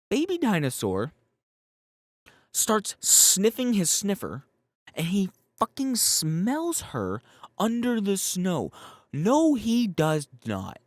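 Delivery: loud, slow, high outraged disbelief; exasperated rant. Real, not acted. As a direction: vent at the absurd scene. Ranting at implausible scene.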